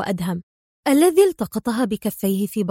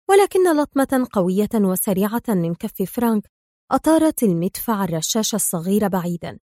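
ordinary delivery

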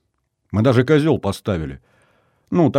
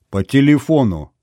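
higher, louder needling answer; mischievous; 5 semitones higher, 5 dB louder than usual. A narrator's reading. Oleinik teasing stunned Isaeva, mischievous. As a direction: say it with a spiteful smile. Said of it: "зловредно улыбнулся Олейник".

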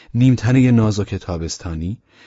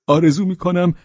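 high, emphatic parting wish; eager character voice; 10 semitones higher, 4 dB louder than usual. Acted. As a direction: call out the wish with lifted voice. (آرزوی پرشور با صدای زیر)

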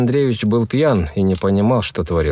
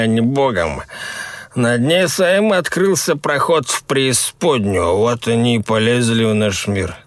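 put-on superstitious drunk lad; 3 semitones higher than usual. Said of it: "Юру опередил Тимур".

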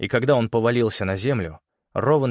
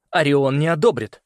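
higher, louder warning; urgent protest; 4 semitones higher, 5 dB louder than usual. A narrator's reading. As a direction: insist under threat. Boy warns his captor.

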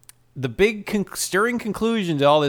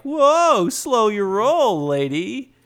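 mock soothing guy; 7 semitones higher, 3 dB louder, much slower than usual. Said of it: "this guy's like".

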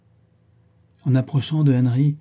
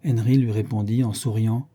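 ordinary delivery

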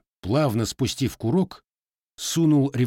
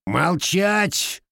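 higher, forceful growled command; 6 semitones higher, 3 dB louder than usual. Acted. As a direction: put the officers in their place. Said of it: "прорычал Лукич".

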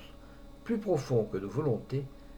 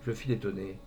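ordinary delivery

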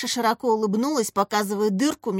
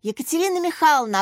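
higher-pitched hail; calling out; 6 semitones higher, 4 dB louder, faster than usual. A narrator's reading. Lyonka hailing Katya, calling out. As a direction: call for the teacher's attention. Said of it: "окликнул Катю Лёнька".

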